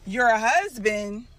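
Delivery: loud, slow, disbelieving; incredulous. Real, not acted. Wife reacting to viewers that the mistress is married, loud and incredulous. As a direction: stretch it out in disbelief.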